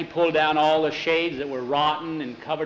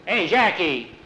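higher, louder hail; calling out; 4 semitones higher, 5 dB louder, slower than usual. Acted. Pedro hails Jackie at work on the wagon, calling out. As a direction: call over to him sharply.

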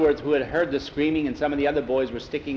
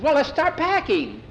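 much higher and louder, can't wait; eager boy; 14 semitones higher, 5 dB louder than usual. Acted. Boy eager to pack for home, high and excited. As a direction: chirp it, ready to go now.

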